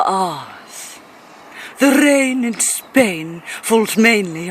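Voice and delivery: drawn-out, over-enunciated posh English with rolled R's; mock posh English. Brit giving America an English lesson, mock posh English. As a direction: ham up a slow, stagey English accent. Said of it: "I don't really talk like that".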